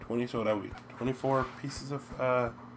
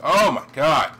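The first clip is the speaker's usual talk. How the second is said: loud, higher cry of alarm; panicked shout; 4 semitones higher, 14 dB louder, slower than usual. Real, not acted. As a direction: yell as if about to die. About to die in game.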